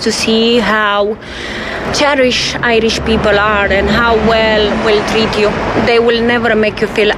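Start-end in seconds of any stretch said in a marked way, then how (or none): none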